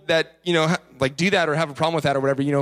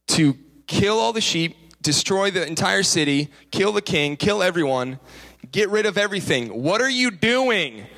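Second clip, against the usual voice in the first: exasperated scolding; 3 semitones higher than usual. Retold or imitated. Prophet confronts the disobedient king, mock exasperated rebuke. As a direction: hammer each order home in mock disbelief.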